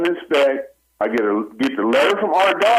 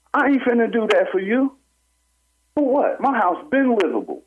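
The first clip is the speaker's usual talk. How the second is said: indignant; 10 semitones higher than usual. Real, not acted. Outraged at demand to tear walls.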